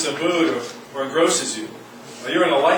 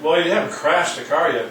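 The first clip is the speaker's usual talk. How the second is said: usual speaking voice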